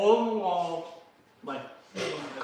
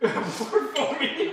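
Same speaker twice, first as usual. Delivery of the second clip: laughing echo; 9 semitones higher, 4 dB louder, slower than usual. Retold or imitated. Teasing echo of friend's request.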